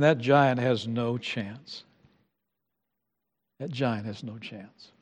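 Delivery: soft, slow, hushed certainty; quiet certainty. Acted. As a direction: drop to a hushed knowing voice.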